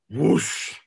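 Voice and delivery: whooshing air noise of a missed swing; imitated swing whoosh. Host mimicking a windmill batter's whiff, whooshing. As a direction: make the swish of a bat hitting nothing.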